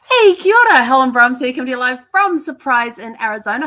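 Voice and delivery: big bright shouted greeting, loud and high; loud, high-energy podcast opener easing slightly after the hello; upbeat show intro. Acted. Host greeting listeners live from Arizona, loud and upbeat.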